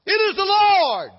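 high, loud, slow proclamation; the Lord names himself to the man; divine voice. Acted.

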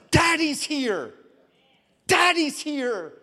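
high, drawn-out call of reassurance; emphatic reassurance. Retold or imitated. Father answering his frightened daughter at the doctor, high emphatic reassurance. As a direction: stretch each word out, pitched high.

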